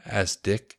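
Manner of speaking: usual speaking voice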